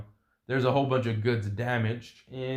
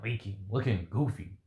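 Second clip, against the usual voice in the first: ordinary delivery, nothing marked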